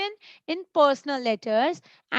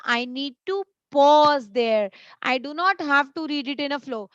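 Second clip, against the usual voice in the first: ordinary talk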